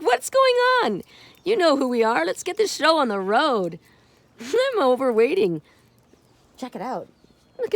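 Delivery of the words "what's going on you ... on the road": high, loud demanding pet; indignant pet voice. Acted. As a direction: squeak it out as the impatient cat. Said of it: "It's like".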